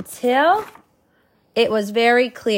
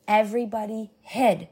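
ordinary delivery

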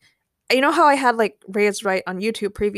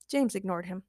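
ordinary delivery

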